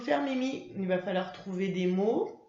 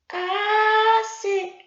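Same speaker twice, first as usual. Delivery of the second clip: put-on much higher, louder, slow child puppet voice; Mimi; 12 semitones higher, 9 dB louder than usual. Mimi the puppet answering her teacher, high child voice. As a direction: answer slowly like an eager little puppet.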